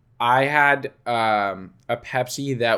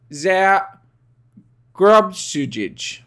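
careful, higher attempt at German; 5 semitones higher, 3 dB louder, much slower than usual. Shown as a demonstration. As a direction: slowly sound out the German. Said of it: "I've been learning some German".

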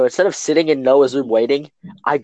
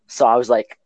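normal talking voice

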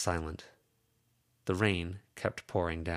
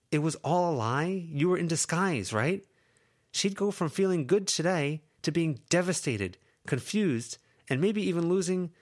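put-on higher young woman's voice, frustrated and pleading; Lucy's voice; 10 semitones higher, 4 dB louder than usual. Lucy scolding her two friends, higher frustrated voice.

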